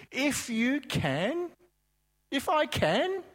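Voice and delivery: high, slow, mocking echo. Jesus mockingly echoing the doubting father. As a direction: toss the words back with a sneer. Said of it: "almost sounds like he's mocking".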